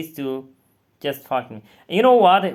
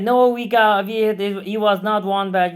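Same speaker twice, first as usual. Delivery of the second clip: higher, louder mock accusation from the screeners; retold loud accusation; 7 semitones higher, 6 dB louder than usual. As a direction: bark it back as the accusing screener would. CIA screeners accusing him, retold.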